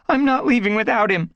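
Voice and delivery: high, loud stubborn refusal. Acted. Squirrelpaw refusing to leave Firestar, stubborn and loud. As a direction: dig in and refuse.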